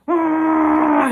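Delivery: loud high raw yell of release; pillow yell. Retold or imitated. Reliving his LSD rage release, yelling into a pillow, loud high scream. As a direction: let out a sudden primal scream. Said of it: "yelling into the pillow".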